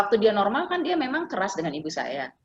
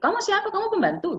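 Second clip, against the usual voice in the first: high, bewildered question; mock confused old man; confused father; 13 semitones higher than usual. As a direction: ask like a man who doesn't know his wife. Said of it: "katanya gitu".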